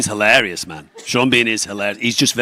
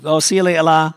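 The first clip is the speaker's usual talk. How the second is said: put-on Scouse accent; 7 semitones higher, 4 dB louder than usual. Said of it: "I really like that in Liverpool".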